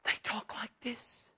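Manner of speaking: slow, breath-only imitation of timid speakers; soft-spoken whisper. Shown as a demonstration. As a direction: drop to a slow whisper, mimicking the meek. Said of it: "Some real soft-spoken guys".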